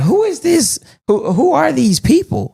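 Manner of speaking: high-pitched mock bewilderment; puzzled imagined reviewer. Host imagining what reviewers would ask, high mock-puzzled voice. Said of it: "so it can be like".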